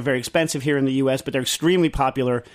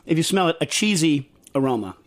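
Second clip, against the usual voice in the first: ordinary delivery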